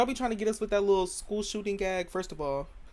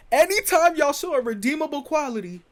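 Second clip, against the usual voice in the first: loud, higher-pitched declaration; emphatic proclamation; 7 semitones higher, 7 dB louder than usual. Real, not acted. Comic roasting British singers, loud emphatic point. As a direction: hammer the point home loudly. Emphatic punch line about accents.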